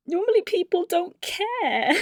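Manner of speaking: high-pitched mock crying voice; teary. Wife tearful at husband's kindness, mock-weepy. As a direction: squeak it out as if welling up. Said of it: "I started getting teary over that".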